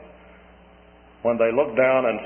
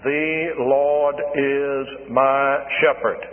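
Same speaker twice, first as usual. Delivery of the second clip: very slow, word by word, no word standing out; evenly stressed reading; much slower than usual. Retold or imitated. Little boy reciting the psalm to the minister, slow and even. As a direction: read it out slowly and evenly like a learner. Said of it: "that's not the way to read it".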